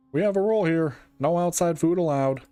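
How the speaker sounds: stern guard. Acted. Stern guard refusing visitor.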